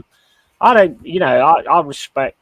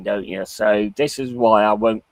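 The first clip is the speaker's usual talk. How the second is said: ordinary delivery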